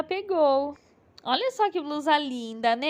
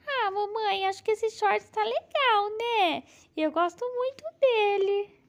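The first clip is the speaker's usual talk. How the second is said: put-on little girl voice; 6 semitones higher than usual. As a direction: play the eager daughter doll. Bela doll picks her shorts.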